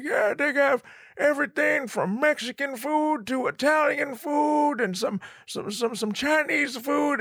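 put-on high, thin old man voice, then a shout; hammed-up high old man shouting. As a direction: squeak it out like a frail old guy.

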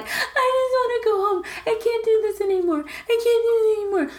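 high, wailing crybaby imitation; hammed-up blubbering, voice way up; mock sobbing. Friend imitating a contestant who wants out, mock sobbing. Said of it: "Like, crying".

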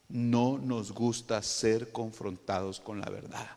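drawn out, measured words; slow it right down for weight; slow emphatic repeat. Shown as a demonstration. Preacher repeats the hard truth to congregation, slowly.

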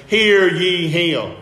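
loud, drawn-out command, each word weighted; emphatic divine command. Retold or imitated. God commands the disciples from the cloud, emphatic. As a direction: proclaim it slowly and loudly. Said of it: "God is saying emphatically from heaven".

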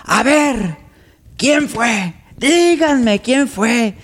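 slow, heavy who-did-it challenge; big tough man demanding. Retold or imitated. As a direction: stretch out the last line like a threat. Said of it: "Se para y dice".

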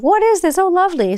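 mock surprise. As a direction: squeak out puzzled then dry sarcasm. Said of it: "I'm like".

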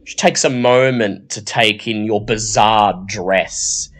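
ordinary talk

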